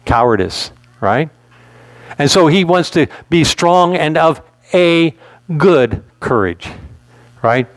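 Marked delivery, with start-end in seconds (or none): 3.3-6.7 "a" drawn out as "ay", with a slow "good courage" after it; stress on A. Shown as a demonstration. Preacher driving the verse home, stressed "a". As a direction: set the word "a" apart with pauses. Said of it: "Why do I emphasize that word "a".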